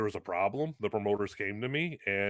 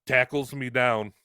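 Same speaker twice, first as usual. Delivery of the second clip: ordinary delivery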